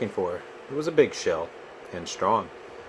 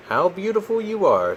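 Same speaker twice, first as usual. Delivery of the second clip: high and loud, full of wonder; a crab in love with what he sees; delighted high admiring crab; 10 semitones higher, 6 dB louder than usual. Acted. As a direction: gush over how lovely they are.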